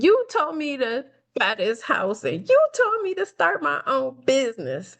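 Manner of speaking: high-pitched angry accusation. Retold or imitated. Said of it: "I'm really pointing, like, I'm angry".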